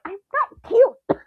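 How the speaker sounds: slow, high, pouting denial; mock pout. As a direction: whine it like a sulky child. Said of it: "And I'm like".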